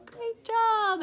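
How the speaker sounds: high, loud teacher praise; bright-pitched acted encouragement; teacher's praise. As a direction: cheer the class on. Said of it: "And I would say".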